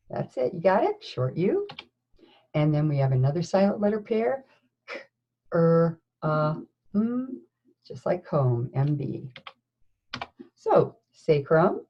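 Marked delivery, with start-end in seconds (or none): none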